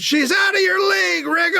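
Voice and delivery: high, carrying heckle; high mock fan shout; imitated scream. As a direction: holler it from the back of the crowd. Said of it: "somebody from the back screams".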